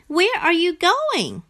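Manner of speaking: high-pitched little frog asking; high, inquisitive storybook frog; curious frog voice. A narrator's reading. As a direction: ask eagerly in a high character voice. Frog questions Rat, reader's Frog voice.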